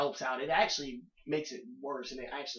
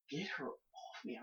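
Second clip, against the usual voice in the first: slow disgusted whisper, much softer; whispered aside; 10 dB quieter, slower than usual. Retold or imitated. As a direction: hiss it under your breath, slowly. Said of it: "And he's just like".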